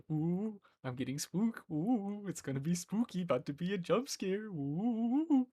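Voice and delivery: high, hammed-up spooky ooh; over-dramatic fake fright, rising high; goofy mock spooked. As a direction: ham up the dread before a jump scare. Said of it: "usually hear me like".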